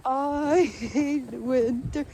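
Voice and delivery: very slow, stretched words; emphatic slow grumble; drawn-out complaint. Real, not acted. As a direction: drag out every word of the gripe. Exaggerated complaint about winter.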